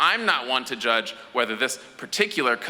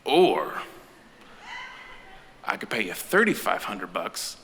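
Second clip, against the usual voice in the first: low conspiratorial dealer; 3 semitones lower than usual. Retold or imitated. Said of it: "and goes".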